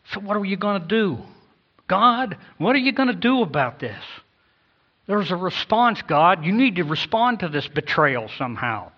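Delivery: a listener demanding God act; pleading challenge to God. Acted. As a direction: press God for an answer. Voicing people challenging God.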